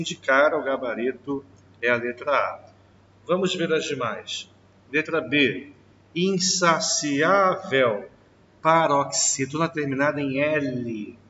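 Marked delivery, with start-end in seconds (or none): 6.2-8.1 slow drawn-out word. Shown as a demonstration. Teacher slowly stretches the example word for the class. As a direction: stretch every syllable slowly. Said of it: "teacher drawing out example word".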